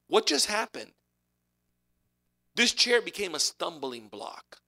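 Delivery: ordinary talk, nothing marked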